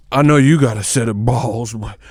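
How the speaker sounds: loud, slow bragging show-off; hammed-up boast. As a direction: brag loudly to the camera, drawing it out. Boasting about his nuts, hammed.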